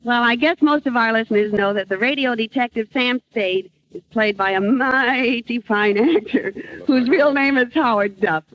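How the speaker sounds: ordinary talk, nothing marked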